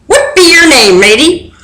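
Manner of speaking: high gruff-free pirate question; pirate captain asking. A narrator's reading. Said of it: "the head pirate asked".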